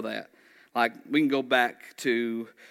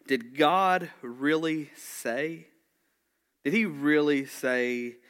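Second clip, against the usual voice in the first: slow, stretched "really", with "say" rising into a question; 3 semitones higher, 3 dB louder, much slower than usual. Acted. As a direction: lean on "really" to sow doubt. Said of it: "He just questioned".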